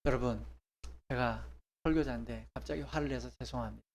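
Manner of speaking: preacher apologizing to the congregation, quiet and calm; calm apology. Shown as a demonstration. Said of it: "그런 다음에".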